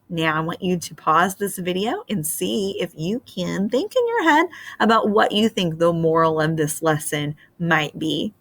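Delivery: ordinary delivery, nothing marked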